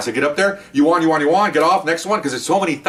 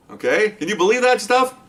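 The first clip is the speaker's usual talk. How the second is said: ordinary talk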